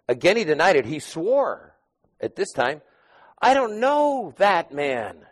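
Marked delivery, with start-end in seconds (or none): 3.4-5.3 slow, high, forceful denial; angry denial. Acted. Peter swearing to the woman, slow angry denial. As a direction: Peter hurling his denial at the accuser. Peter denying Jesus angrily.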